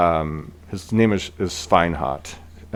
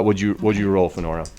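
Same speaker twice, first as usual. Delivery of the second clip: ordinary talk, nothing marked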